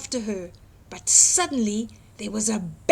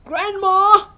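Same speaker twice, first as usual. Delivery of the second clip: high, loud wolf shout; loud call; 9 semitones higher, 12 dB louder than usual. Acted. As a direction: bellow for Grandma as he bursts in. Wolf bursts in calling.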